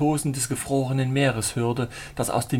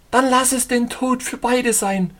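high, louder cry of a woman ready to die; 10 semitones higher, 5 dB louder than usual. Acted. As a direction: fling it out as a high cry together in death. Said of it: "rief sie".

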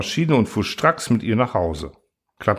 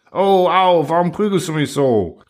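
higher, louder cry of pain; 6 semitones higher, 4 dB louder than usual. Acted. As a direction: yelp under the blows. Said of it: "rief Truhl".

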